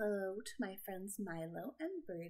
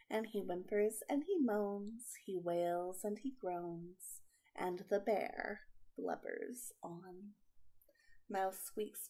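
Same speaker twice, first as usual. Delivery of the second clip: put-on slow, lilting read-aloud for children; storybook reading; 6 dB louder, slower than usual. A narrator's reading. Aunt reading a picture book to kids, gentle and lilting. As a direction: read it like bedtime for little ones.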